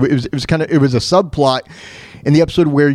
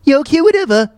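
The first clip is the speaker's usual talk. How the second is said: high, dismissive cartoon voice; Stewie; 11 semitones higher, faster than usual. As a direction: brush it off in a high character voice. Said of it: "Well, Stewie".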